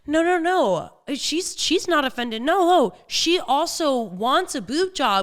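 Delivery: high, dismissive dude voice, a hint of it; mock sleazy guy. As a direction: imitate the pushy boyfriend brushing it off. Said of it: "he's like, he's like".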